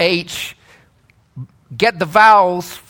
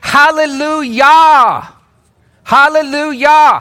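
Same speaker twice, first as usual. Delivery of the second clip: proclaimed; 5 semitones higher, 5 dB louder than usual. Real, not acted. Preacher proclaiming the word.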